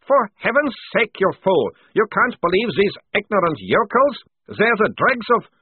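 high, loud exasperated captain; outraged officer's high-pitched protest; indignant outburst. Acted. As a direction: protest furiously at these fools. Captain protests angrily at being disbelieved.

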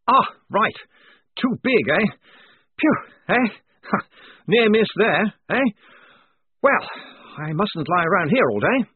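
high, loud nervous relief with a chuckle; relieved, chortling lieutenant; relieved officer. A narrator's reading. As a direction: laugh off the near miss. Blouse's relief.

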